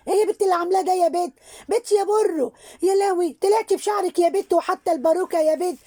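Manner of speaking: put-on high, quick scolding of a cheeky girl; mocking scold. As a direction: tease her like a nosy neighbour woman.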